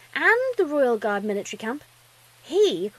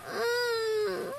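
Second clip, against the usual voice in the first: put-on high, drawn-out childlike whimper; small frightened filly whine; light whine; 11 semitones higher than usual. A narrator's reading. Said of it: "she let out a light whine".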